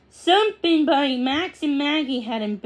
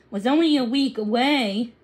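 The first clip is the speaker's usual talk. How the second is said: ordinary delivery, nothing marked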